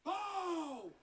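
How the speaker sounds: drawn-out booming winner call; boxing ring announcer. Acted. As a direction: stretch the name out to the crowd. Announcer reads the result.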